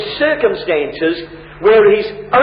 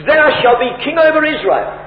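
firmly assured quoted promise, pitched up; emphatic; 6 semitones higher than usual. Acted. Preacher quoting Jonathan's assurance to David, emphatic. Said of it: "But he's emphatic".

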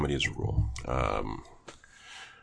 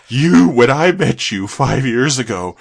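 slow, loud, rising to a high crying shout; indignant shout; 8 semitones higher, 10 dB louder, much slower than usual. Retold or imitated. Retold sketch guy yelling at his friend, slow crying shout. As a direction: wail the accusation like betrayed. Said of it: "He goes".

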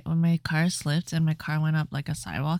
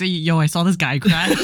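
laughing; 6 dB louder, slower than usual. Pretend. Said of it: "It's like".

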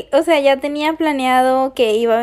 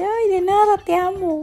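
mock gushing; 7 semitones higher than usual. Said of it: "Y yo así de".

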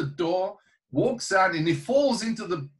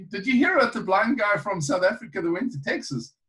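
ordinary delivery

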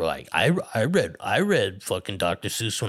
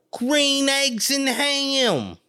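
higher still and very slow; very drawn out; 12 semitones higher, much slower than usual. Retold or imitated. Mother repeating the title, very slow and high. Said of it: "my mother would be calling like".

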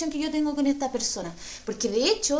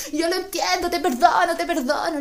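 high earnest forgiveness, a bit louder; mock tender forgiveness; 6 semitones higher, 3 dB louder than usual. Woman forgiving the thief, high and earnest. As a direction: forgive him like a saint.

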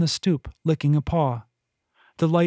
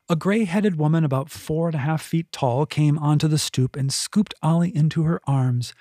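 usual speaking voice

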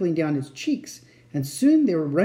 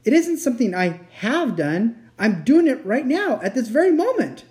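climbing higher, louder and more urgent; anguished fawn, confessing; 7 semitones higher, 5 dB louder than usual. A narrator's reading. Fawn admitting he is betraying her now, anguished. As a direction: build to a high-pitched admission.

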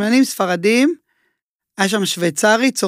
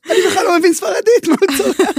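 high, laughing "what, at me?"; laughing disbelief; 11 semitones higher, 3 dB louder than usual. Retold or imitated. To a coworker, amused he can't understand the chef, laughing. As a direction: laugh through the confused question. Retelling his confused reply to coworker.

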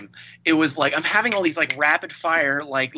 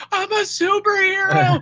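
much higher and louder, proud superhero line; hammed-up heroic declaration, much higher; mock superhero; 14 semitones higher, 4 dB louder than usual. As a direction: squeak it out like the hero announcing himself. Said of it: "crying as he's going".

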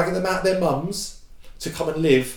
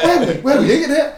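quoting him; 12 semitones higher, 5 dB louder, faster than usual. Retold or imitated. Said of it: "And he went".

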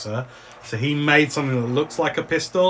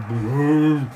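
drawn-out boom, swelling louder; booming noise; 3 dB louder than usual. Acted. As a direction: stretch out a long rolling "boom". Game master sounds out boom.

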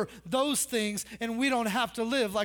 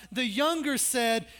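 ordinary talk, nothing marked

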